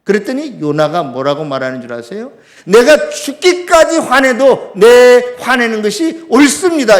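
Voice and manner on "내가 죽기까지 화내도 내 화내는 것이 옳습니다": defiantly triumphant, loud and slow. Acted. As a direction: insist proudly, high and emphatic. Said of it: "이렇게 의기양양해요". (의기양양하게 큰 소리로)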